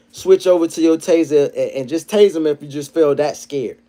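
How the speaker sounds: ordinary talk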